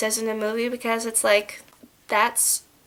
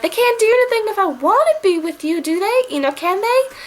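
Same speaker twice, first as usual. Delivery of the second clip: high-pitched imitation of a pleading movie heroine; hammed-up clinging heroine; 9 semitones higher, 3 dB louder than usual. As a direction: plead in a mock lovestruck girl's voice, pitched way up. Acting heroine's clinging movie line.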